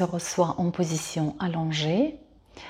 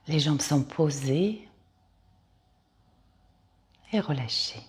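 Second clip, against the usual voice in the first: calm Yoga Nidra guide with long pauses; guided meditation. Acted. Yoga teacher guiding listeners to lie down and release, guided meditation. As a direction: lead them into rest, phrase by unhurried phrase.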